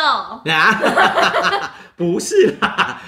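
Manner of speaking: slow, high laughing denial. Real, not acted. Laughing at kid's joke.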